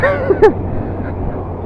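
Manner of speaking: breathy squeaky laugh; high laugh. Real, not acted. Laughing at himself.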